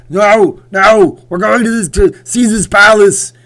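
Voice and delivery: high, bellowed Austrian action-star impression; stubborn action hero yelling; Arnold Schwarzenegger. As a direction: overrule him at a hammy shout. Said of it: "Mike versus Arnold was funny".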